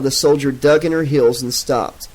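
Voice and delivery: plain reading voice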